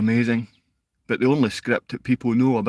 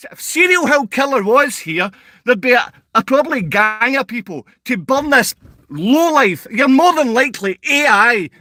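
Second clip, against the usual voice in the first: high, loud, angry tirade; yelling insults at a troll in the chat; furious rant; 10 semitones higher, 3 dB louder than usual. Real, not acted. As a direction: tear into him, voice raised high.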